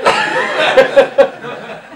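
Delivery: loud breathy laugh; burst of laughter. Real, not acted. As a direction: cracks up at the punchline. Laughs at husband line.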